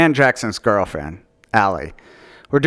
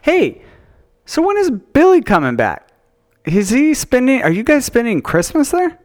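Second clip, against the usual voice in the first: high excited chatter; 13 semitones higher than usual. Real, not acted. Sudden bright new question.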